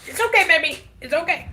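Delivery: high, loud soothing coo; soothing a scared dog. Real, not acted. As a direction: reassure the poor dog. Soothing her sick dog.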